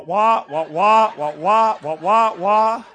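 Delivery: loud muffled wah wah drone; Charlie Brown's teacher. Retold or imitated. Preacher imitating Charlie Brown's teacher for the congregation, loud wah wah. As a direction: honk it like a muted trombone teacher. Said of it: "all I can hear is wah".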